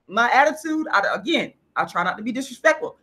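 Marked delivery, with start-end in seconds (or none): none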